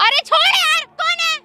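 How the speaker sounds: high-pitched yell to be released; angry protest. Acted. Woman to stranger holding her hand, angry protest. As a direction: cry out high while struggling free. Struggles to get free.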